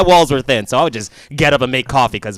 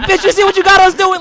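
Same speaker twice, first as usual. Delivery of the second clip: high, loud mock scolding; mock angry scolding; 8 dB louder than usual. Imagined co-wife scolding his wife, mock angry. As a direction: scold her like a fellow wife.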